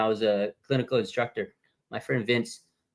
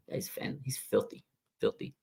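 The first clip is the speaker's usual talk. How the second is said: whisper; 11 dB quieter than usual. Real, not acted. Hushed praise of the player.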